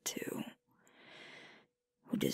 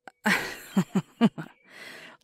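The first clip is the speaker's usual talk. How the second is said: sudden bubbling chuckle; amused laugh; 9 dB louder than usual. Acted. Alpha laughing at a partner's lone wolf joke, amused.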